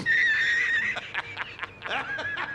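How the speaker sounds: squealing burst of laughter; high shrieking laugh. Real, not acted. Streamer teasing raider about Monopoly, shrieking laugh. Cracks up mid question.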